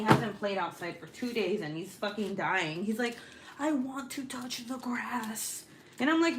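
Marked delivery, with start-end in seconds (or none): 3.6-5.6 put-on higher, slow pleading kid voice; mock whiny child; imitating whiny son. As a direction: whine it like a bored kid. Mimicking her grounded son.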